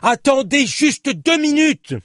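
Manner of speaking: high shout, slowly stretched out; loud cry. Acted. Journalist begging his companion to wait, drawn-out shout. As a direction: yell it slowly, pleading for time. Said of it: "cria Lilio presque aussi fort qu'elle".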